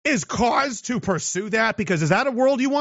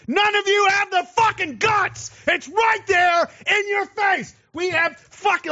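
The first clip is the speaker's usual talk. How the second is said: much higher, yelled, slowing at the end; furious shout; 10 semitones higher than usual. Real, not acted. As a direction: rage at them for their cowardice. Angry rant at listeners.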